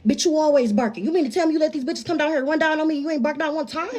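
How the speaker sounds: retold yelling. Girl scolding the dog downstairs, retold yelling. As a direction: act out telling off a useless guard dog. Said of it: "I'm even yelling at the dog".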